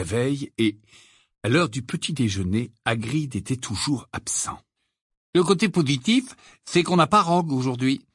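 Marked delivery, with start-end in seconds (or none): 5.3-8.0 invigorated. Acted. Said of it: "d'un ton revigorant".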